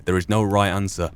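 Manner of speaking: normal talking voice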